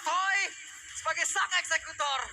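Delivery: hyped caster. Acted. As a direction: ride the hype of the fight.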